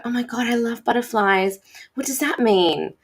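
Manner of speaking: high, gushing schoolgirl voice; imitating an excited pupil. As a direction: gush about the butterfly card. Pupil excited about a card.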